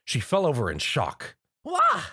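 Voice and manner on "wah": high, sharp yelp of fright; a sudden scared squeal as the sword swings past; startled cry. A narrator's reading. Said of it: "she cried out".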